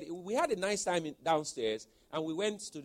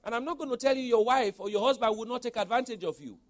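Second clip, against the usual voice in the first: ordinary talk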